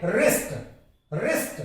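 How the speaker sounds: रिस्ट opened with a clear R; R pronounced. Shown as a demonstration. Teacher models the word twice, R pronounced in रिस्ट. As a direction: sound the R at the start of रिस्ट. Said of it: "इसमें बोला जाएगा आर".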